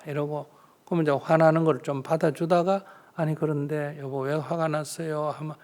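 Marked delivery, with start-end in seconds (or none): 3.2-5.3 soft, unhurried question to a spouse; acted gentle coaxing. Wife asking husband why he is angry, gently. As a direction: ask softly, taking the sting out.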